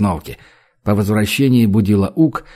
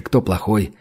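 ordinary delivery

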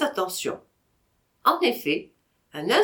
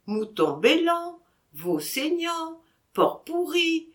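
slow, higher, like a proverb; sententious; 8 semitones higher than usual. Acted. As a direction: pronounce it as a maxim. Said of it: "dit sentencieusement Vatrin". (ton sentencieux)

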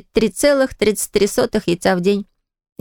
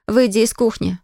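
ordinary delivery, nothing marked

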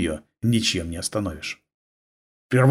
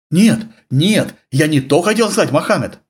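almost wailing; 5 semitones higher, 3 dB louder than usual. Acted. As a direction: panicky near-wail. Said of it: "почти запричитал".

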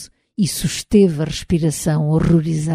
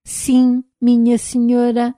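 hoarse robot voice; 5 semitones higher, slower than usual. Acted. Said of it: "fê-lo roucamente, como uma máquina que precisa de óleo".

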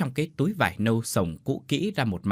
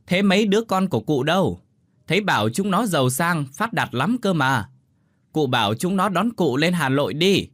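higher, louder, insistent neighbour; urgent advice pitched up; raised-voice urging; 6 semitones higher, 5 dB louder than usual. A narrator's reading. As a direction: press a hard-of-hearing old woman to leave. Raising voice for deaf old neighbour.

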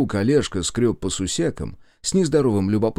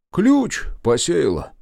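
gloomy; 7 semitones higher, 4 dB louder, slower than usual. A narrator's reading. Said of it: "хмуро пояснил".

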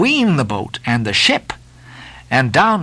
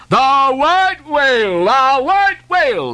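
high, loud, slow hail of the whale; frenzied shout; 12 semitones higher, 4 dB louder, slower than usual. Acted. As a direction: roar it out as the whale is seen. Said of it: "the captain cried".

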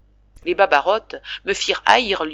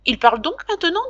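brother asking; 11 semitones higher, faster than usual. A narrator's reading. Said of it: "dit Jacques".

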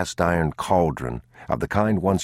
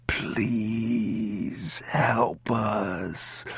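slow drawn-out plea; 6 semitones higher, 6 dB quieter, much slower than usual. A narrator's reading. Prisoners begging, drawn out.

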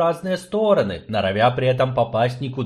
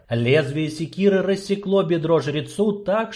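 ordinary delivery

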